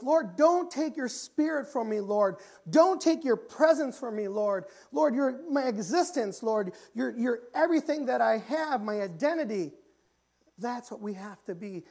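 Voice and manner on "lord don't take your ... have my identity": loud, high impassioned plea; preacher's full-force acted prayer; crying out to God. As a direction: cry out desperately as David begging the Lord. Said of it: "David's cry out saying".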